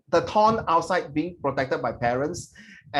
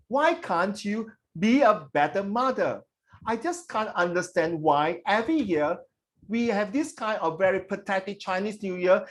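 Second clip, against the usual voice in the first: put-on higher-pitched child pleading with mother; pleading daughter; 6 semitones higher than usual. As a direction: question mom insistently, drawing out the key words. Acts the child in the essay.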